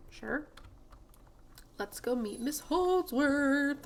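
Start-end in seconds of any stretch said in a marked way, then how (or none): 1.8-3.8 higher, drawn-out eager cheer; excited. Real, not acted. Player off to meet a favourite character, excited. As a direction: stretch it out with eager delight.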